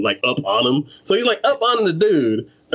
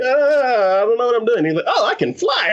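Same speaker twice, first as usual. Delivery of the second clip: goofy clueless guy; 9 semitones higher than usual. Retold or imitated. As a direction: act like a happy idiot.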